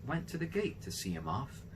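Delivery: usual speaking voice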